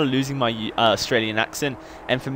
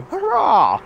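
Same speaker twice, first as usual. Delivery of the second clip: high, loud, drawn-out hurrah; mock triumphant cheer; 6 dB louder than usual. Vlogger celebrating three hundredth video, hammed-up high cheer. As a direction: cheer yourself with hammed-up glee.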